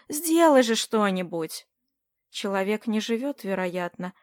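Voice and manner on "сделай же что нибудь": ordinary delivery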